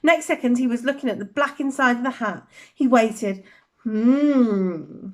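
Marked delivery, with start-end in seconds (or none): 3.8-5.1 small voice. Acted. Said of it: "said a small voice in his ear".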